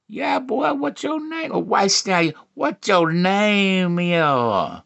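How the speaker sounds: high start, stretched-out finish; imitated flirting woman. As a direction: start high and drag out the last question. Woman's flirty question acted.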